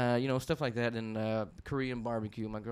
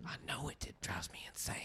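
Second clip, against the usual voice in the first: whisper; 2 semitones higher, 8 dB quieter than usual. Real, not acted. Embarrassed about accent remark.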